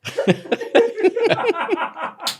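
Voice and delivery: high-pitched burst of laughter at himself; high cackle. Real, not acted. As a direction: cackle at his own failed accent. Laughs at own bad accent.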